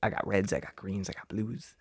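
soft, secretive dealer list; dealer murmur. Pretend. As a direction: softly list the wares. Imitating a street dealer.

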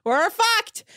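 high-pitched calling out; hammed-up town-crier holler; mock announcement. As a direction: crow the bad news, imitating him. Spreading news round the boat, acted.

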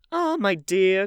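high, loud exclamation; hostess's fond, emphatic outburst; crying out. A narrator's reading. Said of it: "cried Lady Narborough".

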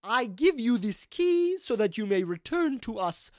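very slow, high, swooping proclamation; ghostly madam. Acted. Ghost gifts the party a key, slow and ceremonious. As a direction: bestow it grandly, stretching each phrase. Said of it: "And she says".

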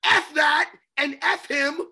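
slow, loud, laughing outburst; angry rant. Real, not acted. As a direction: spit it out with a laugh. Ranting at Michael Irvin.

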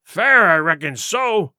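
slow, emphatic pirate retort; indignant retort. Acted. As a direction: throw it back slowly, leaning on the words. Silver's indignant retort to the crew.

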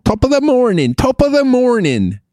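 high, singsong Irish brogue; jovial Irish accent. Acted. Host showing off his jovial Irish greeting, high Irish accent. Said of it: "your Irish guy is, like, the jovial guy".

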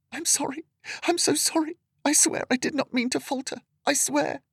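very high, pleading apology spilling out fast; panicked. A narrator's reading. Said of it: "Her voice was becoming more and more panicked".

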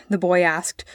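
ordinary delivery

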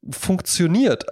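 ordinary talk

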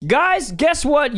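drawn-out hype opener; slow teasing announcement; slow announcer build-up. Acted. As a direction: stretch it like an announcer. Announcing the Patreon plug.

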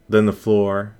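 ordinary delivery

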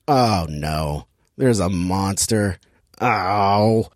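low, slow, flat-toned mock alarm; unimpressed fake horror, dropped low; deadpan oh no. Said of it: "And then I went".

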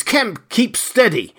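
high, drawn-out urgent plea; pitched high, slow and insistent; urgent cry. A narrator's reading. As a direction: cry out to steady a panicking man. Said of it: "cried the voice".

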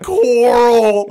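joking about the meme, anguished Rick wail; Coral meme yell. Retold or imitated. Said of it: "my favorite meme of all time".

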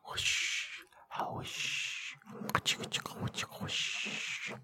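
soft unvoiced spraying whooshes; whispered whooshes. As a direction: hiss out ASMR spray sounds. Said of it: "what kind of golden shower that was".

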